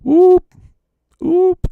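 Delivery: very high loud whoop, rising; bird call. A narrator's reading. Birds calling to their mates, high whooping call. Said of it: "called some to their mates".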